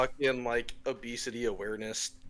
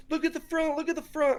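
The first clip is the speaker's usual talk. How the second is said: mock yell. Stranger's friend yells out about the packet, high mock yell. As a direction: imitate a friend yelling out in a squeaky high voice. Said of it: "one of the friends yells out".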